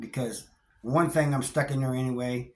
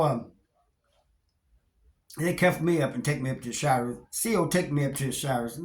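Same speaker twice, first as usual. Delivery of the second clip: ordinary talk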